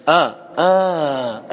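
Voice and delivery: آ isolated, then held very long; short burst, then a sustained آ; demonstrating the alif. Shown as a demonstration. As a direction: draw آ out slowly as a pure long vowel. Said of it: "الألف".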